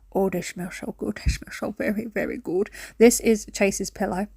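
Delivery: ordinary talk, nothing marked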